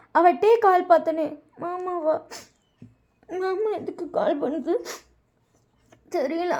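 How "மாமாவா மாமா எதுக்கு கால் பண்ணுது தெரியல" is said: small, soft, higher tearful voice; puzzled through leftover tears; tearful Vayan. A narrator's reading. Crying sister wondering why uncle is calling, softly tearful. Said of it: "wine இன்னும் பெட்ல அழுதுட்டே".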